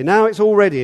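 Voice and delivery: bright loud call that the feast is ready. Retold or imitated. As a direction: call it out to the whole street. Servant calling guests to come.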